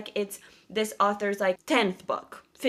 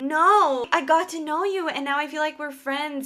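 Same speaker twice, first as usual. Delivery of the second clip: mock plea; 7 semitones higher, 5 dB louder than usual. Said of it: "I'm like".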